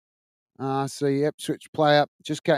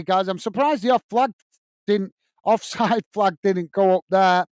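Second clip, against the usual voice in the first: ordinary delivery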